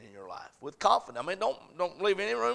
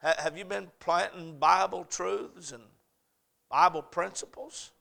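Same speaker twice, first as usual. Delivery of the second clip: ordinary talk, nothing marked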